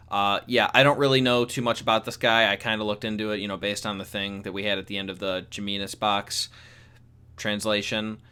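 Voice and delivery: usual speaking voice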